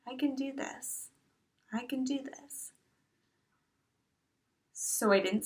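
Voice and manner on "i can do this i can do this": very soft, private resolve; hushed little mantra; quiet self-encouragement. Retold or imitated. Quiet promise to herself.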